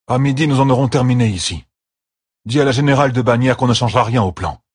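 a lord issuing orders, brisk at the end; Perrine's commands. A narrator's reading. (ordres fermes et plus forts)

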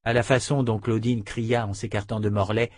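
plain reading voice

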